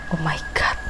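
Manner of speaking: breathless scared whisper; whispered oh my God. Real, not acted. Narrator whispering in fear at the story. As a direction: hush it out in fright.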